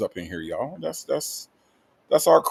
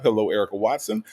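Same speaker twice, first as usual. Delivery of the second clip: usual speaking voice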